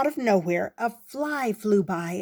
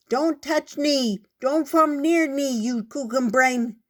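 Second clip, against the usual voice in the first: muffled into the dirt; 6 semitones higher, 5 dB louder than usual. A narrator's reading. Ant with head in the dirt yells at the fly, muffled. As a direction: shout with your face in the dirt. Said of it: "it came out a little strange".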